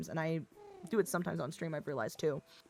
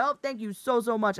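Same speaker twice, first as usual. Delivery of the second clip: raised, loud, over-polite thanks; dad Midwestern goodbye; 5 semitones higher, 5 dB louder than usual. Shown as a demonstration. Guest escaping an event, mock Midwestern dad politeness. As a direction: burst into cheery parting thanks. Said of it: "You just be like".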